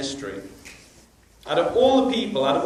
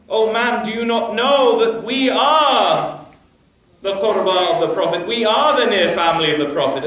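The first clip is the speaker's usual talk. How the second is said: forceful declaration; 3 semitones higher, 6 dB louder than usual. Acted. The Imam tells the old man who they are, loud and insistent. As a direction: press the truth on the man.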